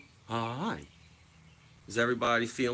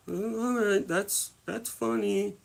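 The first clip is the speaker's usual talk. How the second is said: high, slow sarcastic drawl; mock sarcastic; 11 semitones higher, slower than usual. Answering friends who kick him, high sarcastic drawl. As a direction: play along, dry and sarcastic. Retold reply to kicking friends.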